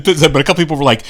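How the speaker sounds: ordinary talk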